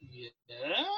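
low, soft, rising yeah; sheepish confirmation. Retold or imitated. Recruit confirming his degree to another, sheepish. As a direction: answer softly, as if obvious.

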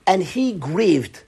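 slow, mild grieving voice; a touch of mourning, drawn out; imitating grief. Rabbi imitating the grieving Kloisenberger, slow and mournful. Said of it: "[name] imitates him grieving".

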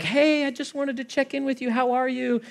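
high, sweet fake-cheery check-in; mock overly sweet text message voice; chirpy friendly text voice, hammed up; sugary text voice.

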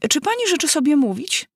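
a hint of polite, pointed courtesy; polite mouse. The mouse asks the parrot, mock polite. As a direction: ask politely with a pointed edge. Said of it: "odrzekła mysz grzecznie".

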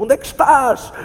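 loud search cry across dark water; shouted call. Acted. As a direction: call out desperately into the storm.

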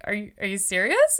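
can't believe what she's asking; surprised disbelief. Retold or imitated. Stylist answering client's Reiki request, loud surprised disbelief. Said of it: "And I was like".

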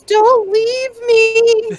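high, drawn-out wail of don't leave me; whiny plea. Retold or imitated. Retelling a lost girl's cry, high drawn-out wail. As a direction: stretch it out like a scared kid begging. Retelling the episode's opening hook.

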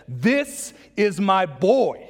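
slow, drawn-out proud declaration; booming Father's voice. Acted. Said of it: "The voice of the Father booms over".

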